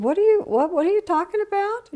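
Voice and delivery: high, rushed, stunned question; shocked disbelief. Retold or imitated. Sister on the phone stunned to hear about the crash. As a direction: voice jumping up in alarm.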